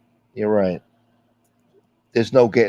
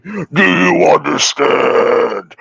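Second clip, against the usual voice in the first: very slow, louder, drawn-out "Do you understand?"; mock menace; 4 semitones higher, 7 dB louder, much slower than usual. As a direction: stretch "Do you understand?" out slowly like a movie villain. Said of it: "He's like".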